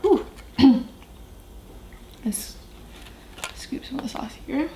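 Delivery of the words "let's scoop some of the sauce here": whispering. Real, not acted. Eating mukbang, whispered aside.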